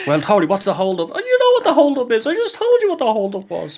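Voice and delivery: high-pitched retold office squabble; mock exasperated bickering. Worker acting out boss asking about the holdup, high mock exasperation.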